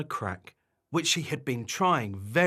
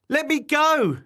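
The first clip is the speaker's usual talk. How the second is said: high, loud demand; high-pitched storybook outburst; indignant yell; 7 dB louder than usual. Acted. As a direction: yell to be released. Barbara struggles against her wobbler.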